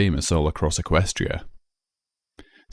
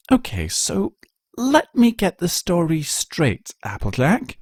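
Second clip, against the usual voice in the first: lightly raised, measured hostess voice; a hint of the refined unicorn listening; gracious Rarity; 6 semitones higher than usual. Rarity invites tea, recaps story.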